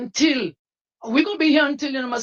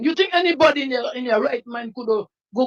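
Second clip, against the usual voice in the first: ordinary talk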